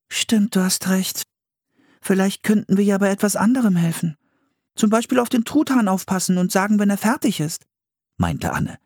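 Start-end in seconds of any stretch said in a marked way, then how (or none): 0.1-7.6 put-on high young girl, faster at the end; girl voice, protesting. A narrator's reading. Anne refuses to collect old clams, high and upset. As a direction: she can't bear to kill them.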